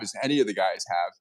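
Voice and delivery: ordinary talk, nothing marked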